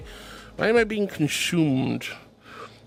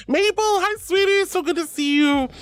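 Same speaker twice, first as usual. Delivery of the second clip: much higher, sing-song hello; high sweet greeting; 9 semitones higher than usual. Real, not acted. Greeting Steve in chat, high and sweet. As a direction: squeal a happy hello.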